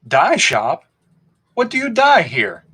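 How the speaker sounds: lightly mocking question, rising high; playful teasing that lifts at the end; half teasing. A narrator's reading. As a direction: poke fun at the shopkeeper a little. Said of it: "half teasing".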